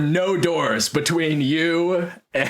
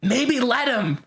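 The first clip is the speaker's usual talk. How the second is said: mock shout; 11 semitones higher, slower than usual. Confused doctor to the others, high mock shout. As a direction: call it out high over the group. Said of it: "One of them shouts".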